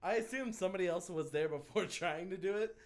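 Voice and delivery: ordinary talk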